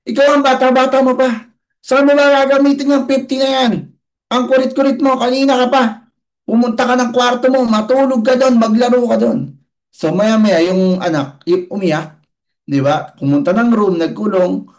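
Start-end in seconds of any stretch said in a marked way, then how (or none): 0.1-9.6 angry father. Retold or imitated. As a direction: scold the pestering child. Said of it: "Nagalit na".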